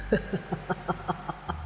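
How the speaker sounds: breathy, wild demon laugh; savage demon cackle. Acted. Demon gloating at the exorcist, savage cackle. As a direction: cackle with cruel delight. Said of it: "con quỷ cười lên những tiếng man rợ khoái trá".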